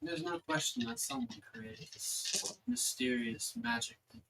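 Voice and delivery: lightly voiced celestial being, measured with long pauses; an understated goddess pondering mysteries, slowing on key phrases.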